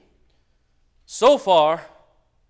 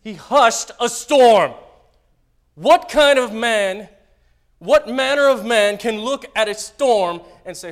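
high, loud, slow preaching; preaching at full force; 9 semitones higher, 16 dB louder, slower than usual. Acted. Pastor thundering to the congregation about Jesus. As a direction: drive the wonder home.

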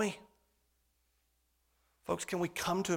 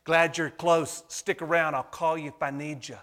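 mock casual brush-off; 8 dB louder than usual. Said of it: "we gladly acknowledge his presence".

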